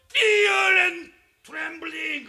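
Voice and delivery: high, loud, very drawn-out Fear and trembling; shouted English. Shown as a demonstration. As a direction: bellow Fear out long. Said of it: "소리를 지르냐면".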